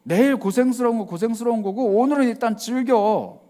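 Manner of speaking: high, loud careless voice; a loud, reckless "live for today" voice; carefree spender. Retold or imitated. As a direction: brush off tomorrow, spend today. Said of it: "이 말씀이 아니라는 거예요".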